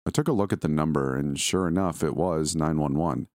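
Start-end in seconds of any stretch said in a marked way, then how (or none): none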